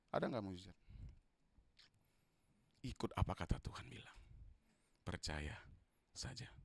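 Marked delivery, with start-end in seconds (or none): 2.8-6.5 hushed whisper. Acted. Whispered reverent repetition.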